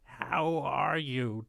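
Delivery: put-on very slow frail old man; weakly ask, a dying man. Dying pie maker voiced.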